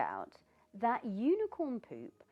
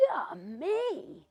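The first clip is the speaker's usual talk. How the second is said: delighted yummy; 6 semitones higher, 4 dB louder than usual. Acted. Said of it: "Cat thought".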